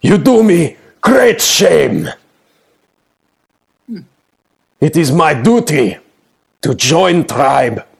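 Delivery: high, slow offended demand; wounded warrior pride. Acted. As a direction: insist with hurt pride. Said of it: "like you kicked his dog".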